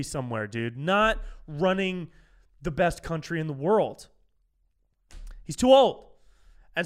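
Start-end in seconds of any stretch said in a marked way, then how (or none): 5.4-6.1 1 exasperated outburst. Real, not acted. Exasperated point about Biden.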